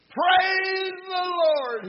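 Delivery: very high, drawn-out joyful holler; elderly church lady's high-pitched holler; exultant shout. Acted. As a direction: shout it to the heavens, stretching every word. Lady shouts toward heaven.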